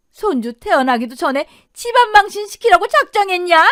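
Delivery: loud, high-pitched scolding shout; raging mother-in-law; furious berating. Acted. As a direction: shout her down in outrage. Mother-in-law berates pregnant daughter-in-law.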